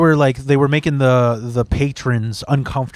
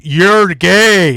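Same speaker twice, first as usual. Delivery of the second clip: louder, higher mock jeer; mock heckler; 4 semitones higher, 5 dB louder than usual. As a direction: holler a dumb insult like a heckler. Mocks generic heckler's insult.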